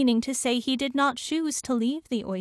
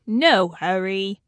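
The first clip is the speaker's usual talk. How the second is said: loud, slow, drawn-out reply; easygoing, stretched-out character voice; unhurried carpenter; 3 semitones lower, 6 dB louder, slower than usual. A narrator's reading. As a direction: take your time as the carpenter. Carpenter answers the oysters.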